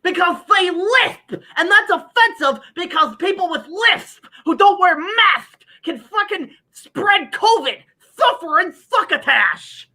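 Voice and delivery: lightly voiced cartoon lisp; mock Sylvester lisp. Mocking lisping cartoon characters.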